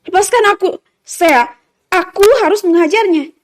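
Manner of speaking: worked-up, insistent cry; agitated demand. A narrator's reading. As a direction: struggle against being held back. Held back from a fight.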